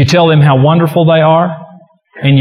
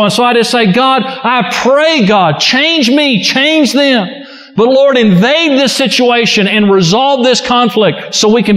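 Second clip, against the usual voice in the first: raised, fervent preaching and prayer; 7 semitones higher than usual. Acted. Pastor to congregation, higher fervent preaching. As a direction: lift the pitch and press the plea. Preaching and praying at rising force.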